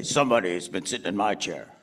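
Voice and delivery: low bear voice; Papa Bear. Shown as a demonstration. Performer feeds Papa Bear the chair line, low voice. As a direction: drop it down low for the father bear.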